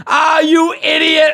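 high teasing shout, slow; mocking laugh. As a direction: laugh and call him an idiot. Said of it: "It was like".

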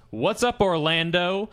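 retelling dad's confident hello; retold calling out. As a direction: call out a greeting across the tunnel. Retold greeting called to player.

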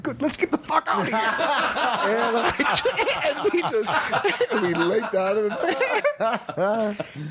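giggling as the story peaks; laughing. Real, not acted. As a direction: laugh your way through the escape. Laughing at the punchline.

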